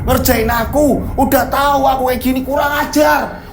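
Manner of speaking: high, outraged cursing; angry yelling. Acted. Becak driver cursing a ghost while pedalling, angry yelling. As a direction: yell curses at the ghost that tricked you. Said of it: "dia teriak-teriak".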